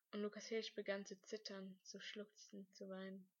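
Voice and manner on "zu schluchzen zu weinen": ordinary delivery, nothing marked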